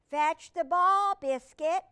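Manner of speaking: high, loud, stretched-out call to a dog; calling a command. Acted. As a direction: call slowly and loudly to the puppy. Girl commands puppy in story.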